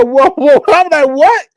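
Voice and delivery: loud, high recoil; startled protest. Retold or imitated. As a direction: back someone off in comic alarm. Retold reaction to being touched.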